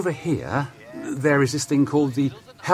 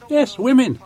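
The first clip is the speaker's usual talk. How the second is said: excited; 13 semitones higher, 7 dB louder than usual. Acted. Stressing women can lead.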